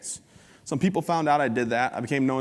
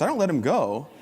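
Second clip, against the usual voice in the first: normal talking voice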